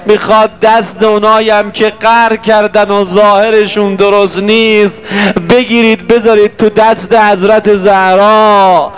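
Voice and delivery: impassioned sermon to brothers and sisters; preaching. Acted. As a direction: exhort the crowd with fervour. Preaches to the congregation.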